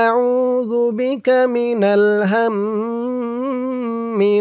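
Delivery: slow, drawn-out melodic recitation; Quranic recitation. Shown as a demonstration. Teacher reciting the dua for viewers, slow drawn-out chant. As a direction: stretch every vowel in a measured chant. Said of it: "আর আমি যেখানে যেমন টান দিব".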